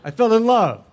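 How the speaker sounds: high, loud emphatic declaration; gushing. Real, not acted. Actor telling audience of his adored director, gushing.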